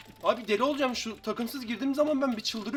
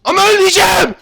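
screamed high and loud in panic; desperate scream; 11 semitones higher, 18 dB louder than usual. Real, not acted. Gamer facing death in the game, screaming in panic. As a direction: yell it out as you're about to lose.

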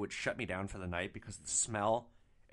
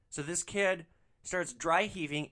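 usual speaking voice

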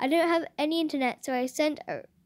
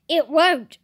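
louder emphatic no; loud protest; 2 semitones higher, 9 dB louder than usual. Real, not acted. Telling her friend the cat refuses, loudly. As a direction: insist it flatly won't. Defiant answer about the cat.

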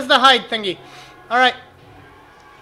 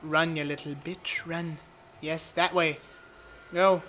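quiet urging; 5 semitones lower, 8 dB quieter than usual. Real, not acted. Urging his character quietly.

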